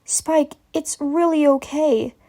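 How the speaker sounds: tender comforting mare; calming. A narrator's reading. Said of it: "in a more calming whisper".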